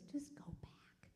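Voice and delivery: slow, hushed whisper; little inner voice. Retold or imitated. Spirit nudging a man to turn back, whispered. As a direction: whisper it slowly, like a nudge from within. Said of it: "the little voice just says".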